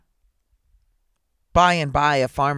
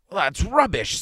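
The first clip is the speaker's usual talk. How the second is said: louder, higher scoffing dismissal; severe; 7 semitones higher, 5 dB louder, slower than usual. A narrator's reading. Magistrate to his prisoner, severe. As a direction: reject it sternly, with force. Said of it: "eyeing the prisoner severely".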